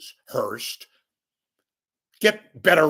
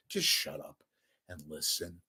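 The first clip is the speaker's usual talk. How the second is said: much softer, muttered shut up and listen; softer, weary version of the demand; quiet demand; 8 semitones lower, 12 dB quieter than usual. Real, not acted. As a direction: drop it to a low, fed-up grumble. Ranting at critics.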